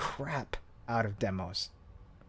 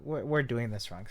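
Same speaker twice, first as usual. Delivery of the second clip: ordinary delivery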